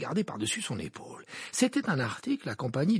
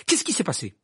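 loud, raised-pitch urgent question; fervent; 7 semitones higher, 7 dB louder than usual. A narrator's reading. Harry pressing the professor for news, fervently. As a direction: demand it with heat. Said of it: "dit Harry avec ardeur".

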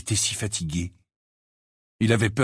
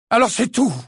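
much higher and louder outburst; 13 semitones higher, 6 dB louder than usual. Acted. Harry exploding at the portrait, loud. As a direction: fling it out angrily. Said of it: "lança-t-il d'une voix forte".

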